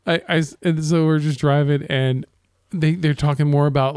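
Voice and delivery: usual speaking voice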